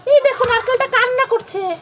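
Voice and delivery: put-on high, urgent kid voice; worried child. Child telling mummy the coconut is crying, high and urgent. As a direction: run to mum with bad news.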